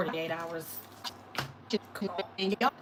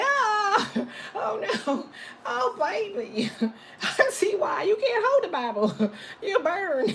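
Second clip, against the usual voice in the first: higher, drawn-out oh no, then laughing; mock shocked stranger; 8 semitones higher, slower than usual. Stranger refusing the Bible money, mock horrified. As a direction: clutch your pearls in pretend horror. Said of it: "He's like".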